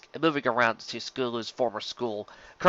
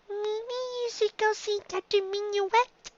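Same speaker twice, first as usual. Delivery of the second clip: high, slow little filly voice; young filly voice; 6 dB quieter, slower than usual. A narrator's reading. Dinky answering her teacher in a high filly voice. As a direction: play a small shy filly.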